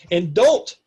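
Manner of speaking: DON'T punched loud with rising pitch; acted warning, heavy stress on "don't"; stern warning. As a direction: lean hard and loud on "don't". Acted character giving angry warning.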